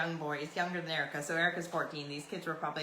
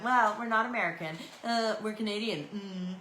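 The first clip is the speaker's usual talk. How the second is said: higher, louder mock-bratty comeback; childish retort; 4 semitones higher, 3 dB louder than usual. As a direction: throw it back like a petulant kid. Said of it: "I was just as childish as they were".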